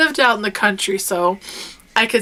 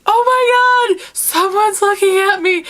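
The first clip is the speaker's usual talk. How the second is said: high, loud frightened squeal; imitating a terrified sister's cry; panicked shriek; 11 semitones higher, 4 dB louder than usual. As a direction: squeal it like a scared kid sister. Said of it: "my sister's like".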